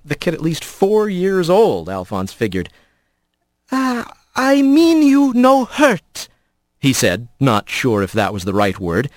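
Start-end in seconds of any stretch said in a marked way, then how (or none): 3.7-6.3 gentle halting. Acted. Careful halting foreign speech.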